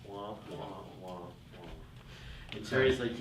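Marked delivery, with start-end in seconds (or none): 0.0-1.7 soft droning wah-wah, muted horn style; mock the boss's meaningless droning, quietly; Charlie Brown's teacher. Employee mocking the boss's speeches as a soft wah-wah drone. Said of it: "sound like Charlie Brown's teacher".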